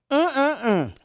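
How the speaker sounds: high, loud pleased hum; yummy hum. Real, not acted. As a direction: savor it with an mm-mm-mm. Tasting the soup.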